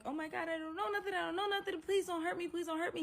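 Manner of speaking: high, fast, frightened begging; panicked pleading. Retold or imitated. Begging the man holding the door, high panicked pleading. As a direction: plead for your life. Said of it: "I'm panicking now".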